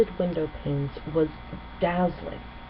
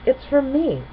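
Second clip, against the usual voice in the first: Cadance, gentle; 8 semitones higher than usual. Acted. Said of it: "Cadance said".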